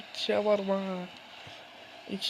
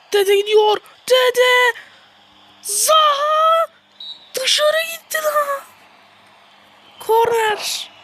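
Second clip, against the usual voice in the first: high, loud, building play-by-play; excited commentator; 12 semitones higher, 6 dB louder than usual. Pretend. Gamer calling his match, rising into a commentator's shout. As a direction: yell the chance like a stadium announcer.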